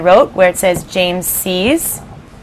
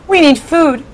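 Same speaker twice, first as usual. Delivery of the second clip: demanding centipede; 8 semitones higher, slower than usual. Acted. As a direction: insist on it like a hungry centipede. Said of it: "he said".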